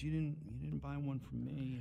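put-on sad little girl. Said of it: "she goes".

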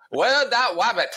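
high, slow cartoon hunter with w for r; drawn-out, high and silly cartoon hunter; Elmer Fudd. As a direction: do a goofy rabbit-hunting cartoon voice. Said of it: "your Elmer Fudd impression".

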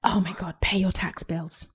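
breathless whisper, pleading; whispered plea. Real, not acted. To listeners who skip tax bills, a whispered plea. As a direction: hush it like a desperate plea. Exasperated plea about taxes.

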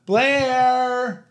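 mock a call that sounds like only a suggestion; limp mock call. Teasing her husband's weak call to the dog, lightly mocked. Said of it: "You were more of a".

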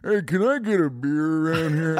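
slurred-out impression of an actor's odd voice; put-on movie-villain voice; Ted Levine. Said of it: "he talks like that".

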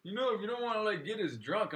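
high, lightly voiced dad explaining; imitating girlfriend's dad. Comedian imitating the dad's reasoning, lightly voiced. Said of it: "That's how he sounds".

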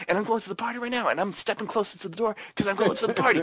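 a mock vain man primping for a party; hammed-up Willis. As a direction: preen before the mirror, giddy to leave. Hammed Willis mirror monologue.

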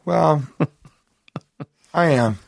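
laughing, unconvincing I am; tone contradicting words. Real, not acted. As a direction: claim excitement while chuckling. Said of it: "you don't seem that excited".

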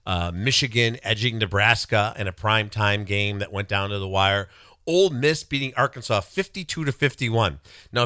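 ordinary talk

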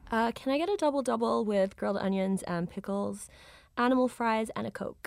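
easy American drive-thru accent. Shown as a demonstration. Australian guest ordering from drive-thru person, American accent. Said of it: "when my American accent comes out".

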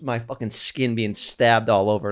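ordinary talk

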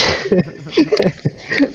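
short high laugh; burst of laughter. Real, not acted. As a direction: laugh at your own joke. Laughs at merch joke. (risa espontánea)

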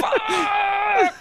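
sudden high yelp at a calendar slip-up; mock curse. Host retelling a calendar mix-up, blurting a high yelp. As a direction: blurt it out in a Chicago-accented panic. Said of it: "And I'm like".

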